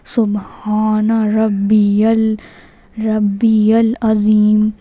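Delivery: slow سبحان ربی ال with a clear z in العظیم; correct recitation. Shown as a demonstration. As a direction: model ربی العظیم as "rabbi-yal azeem", drawn out. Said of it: "زا کی آواز نکالنی ہے".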